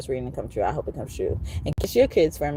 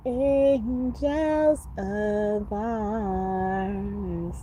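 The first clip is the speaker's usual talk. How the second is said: drawn-out emphasis; 4 semitones higher, 4 dB quieter, much slower than usual. Real, not acted. Emphasis on the angel.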